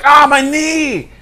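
hammed-up cry of pain, high and drawn out; mock the bad actor's slow, high wail; David Beckham. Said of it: "also when he's like".

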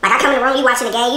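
ordinary delivery

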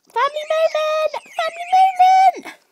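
very high, loud announcing shout; gleeful shouted call; excited shout. Real, not acted. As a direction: belt it out to gather everyone. Calling everyone together.

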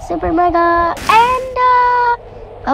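slow, high hyped announcer. Pretend. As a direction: call it out like a move name. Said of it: "with your Endo sound effect".

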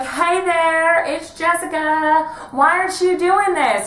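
high, loud sing-song drag host; bubbly drag queen. Retold or imitated. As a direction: chirp it like a hostess. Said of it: "she was like".

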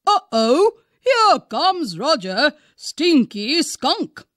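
loud singsong teasing; mock the smelly outsider; taunting animals. A narrator's reading. Woodland animals jeering at Roger Skunk, loud and taunting. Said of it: "the other tiny animals would cry".

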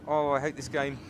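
ordinary talk

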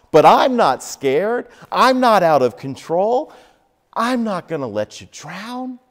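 Jesus promising he never left; preacher voicing Jesus's fervent comfort; reassuring Jesus.

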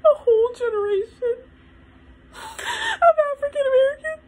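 high, tearful voice breaking with sobs; overwhelmed, crying hard in a very high pitch; crying, real breakdown. Real, not acted. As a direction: push the words out through real tears. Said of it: "I'm getting emotional".

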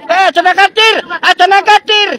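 loud, high shouted warning; sharp warning shout. Real, not acted. Rescuer warning the survivor off the outrigger, loud and high. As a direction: yell to keep them off the outrigger.

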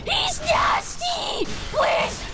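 very high shriek of alarm; high, frantic yelling; startled screaming. Real, not acted. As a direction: scream it in shock at an enemy. Startled by enemy in game.

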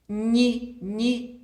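ні, ні spoken slowly and clearly; teacher modelling the syllable ні; syllable drill. Shown as a demonstration. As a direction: drill ні, ні for the children. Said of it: "Вимовляємо н".

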